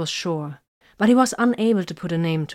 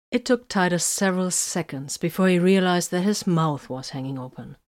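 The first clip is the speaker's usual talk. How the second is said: ordinary delivery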